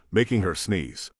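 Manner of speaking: ordinary delivery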